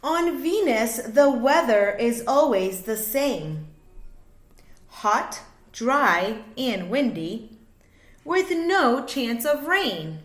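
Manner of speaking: lively, sing-song picture book reading; reading to children. A narrator's reading. Reading a rhyming space book to kids, sing-song. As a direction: bounce the rhymes for little listeners.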